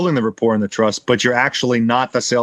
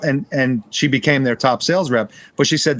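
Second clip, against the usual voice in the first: ordinary delivery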